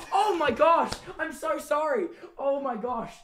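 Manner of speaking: high, loud gasping oh my gosh; horrified at own slip, laughing; real embarrassed shock; shocked apology. Real, not acted. Shocked after swearing on stream.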